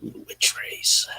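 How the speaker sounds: hushed, breathy whisper. Pretend. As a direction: whisper it like a secret. Teasing about the witch race.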